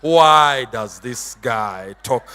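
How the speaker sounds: low, slow sneering question; mocking scribe voice. Scribe sneering at the newcomer preacher, low and mocking. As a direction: drag it out like a sneering critic.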